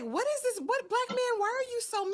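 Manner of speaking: very high-pitched disbelief; high, incredulous questioning; baffled protest. Retold or imitated. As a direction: squeal it in mock bewilderment. Acts own baffled reaction to him.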